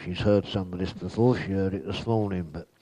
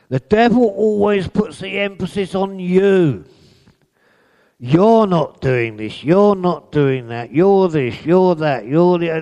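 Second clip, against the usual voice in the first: loud, high hammering preach; loud pulpit accusation run; 9 semitones higher, 8 dB louder than usual. Acted. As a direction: hammer each you're line home.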